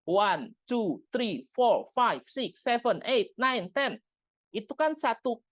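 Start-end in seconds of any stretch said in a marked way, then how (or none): 0.1-4.0 crisp one-beat count, climbing higher; counting in English. Shown as a demonstration. Teacher counting one to ten in English for parents. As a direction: tick off each number in one breath. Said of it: "hitung deh satu sampai sepuluh dalam bahasa Inggris".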